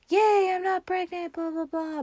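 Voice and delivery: high-pitched hammed-up celebration fading to blah blah; mock cheer. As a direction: gush it like a giddy teen, hammed-up. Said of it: "she's all like".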